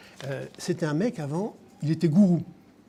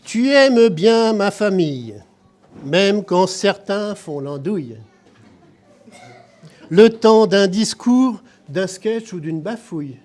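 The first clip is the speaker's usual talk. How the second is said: loud declaimed birthday verse, some lines slow; loud ceremonial poem reading; poem recital; 6 semitones higher, 7 dB louder, slower than usual. Acted. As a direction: read the tribute poem to the room, projecting. Reads birthday poem aloud.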